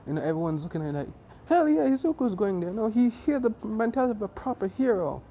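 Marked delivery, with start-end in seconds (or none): 1.5-5.2 high, loud classmates' cheer; excited cheering. Acted. Classmates backing Izuku as a proper hero, loudly excited. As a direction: back him with loud enthusiasm.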